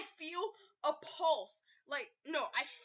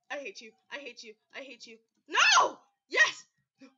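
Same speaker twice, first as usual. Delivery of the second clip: ordinary talk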